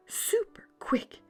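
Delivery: ordinary delivery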